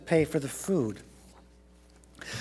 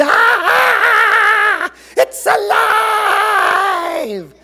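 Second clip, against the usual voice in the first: very high, loud, drawn-out scream; terrified yell; 9 dB louder, much slower than usual. A narrator's reading. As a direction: scream in panic, stretching the last word. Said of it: "The yay lady screamed".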